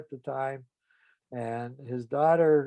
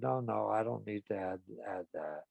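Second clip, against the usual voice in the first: much softer, sinking ashamed retreat; 3 semitones lower, 10 dB quieter than usual. Retold or imitated. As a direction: shrink away in shame, barely voicing it. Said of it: "he felt ashamed of himself".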